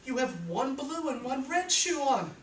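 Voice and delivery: [name] pointing out. Acted.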